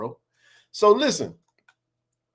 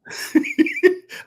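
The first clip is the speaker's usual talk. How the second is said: laughing. Real, not acted. Laughs at statute of limitation.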